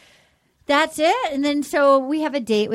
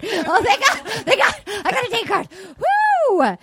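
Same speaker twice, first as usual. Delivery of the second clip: high, quick squeal of relief; over-excited contestant imitation; mock elation; 5 semitones higher than usual. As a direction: gush it fast and cap it with a high whoop.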